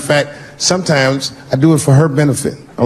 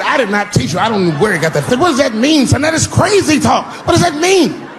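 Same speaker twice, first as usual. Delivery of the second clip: high protest; 11 semitones higher than usual. Retold or imitated. Dad flustered before the parents, high shout.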